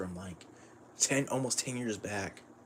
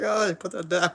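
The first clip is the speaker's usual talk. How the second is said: higher and louder, flustered; snatch it away in a panic; 8 semitones higher, 5 dB louder than usual. Retold or imitated. Embarrassed husband caught out by his wife, flustered high voice. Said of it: "He's like".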